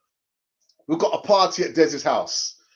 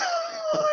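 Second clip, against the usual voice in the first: high giggle. Real, not acted. Laughing mid-sentence.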